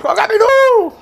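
high, loud call across the room. Retold or imitated. Coach calls out to the player after the goal, loud call. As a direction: shout the name to get his attention. Retelling Paixão calling out.

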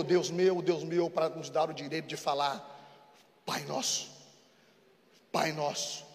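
soft, low, intimate preaching; pregação terna. Acted. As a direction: comfort the grieving listener gently. Quiet tender preaching. (pregação suave e íntima)

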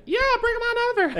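squeaky high fast mock voice; shrill cartoonish high-pitched quip. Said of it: "I don't think they're like".

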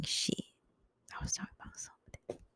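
very soft, quick whisper. Real, not acted. Trying to remember what she was talking about, whispered. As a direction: whisper it fast to yourself. Muttering to herself after losing thread.

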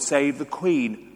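usual speaking voice

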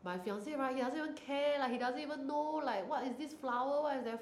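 high whiny complaining fiancée; complaining girl. Retold or imitated. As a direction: whine like a fed-up bride. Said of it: "you know how girls always complain".

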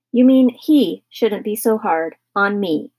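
slow, loud, heavily stressed indignation; annoyed comeback, each word leaned on; angry retort. Acted. Husband snapping back at his wife, slow and angry. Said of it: "angrily ripping a piece of bread".

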